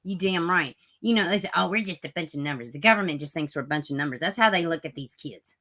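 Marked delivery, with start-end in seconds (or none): 1.6-4.2 mocking quote. Mocking people who say they're just numbers, fast. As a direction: rattle it off like a tired cliché.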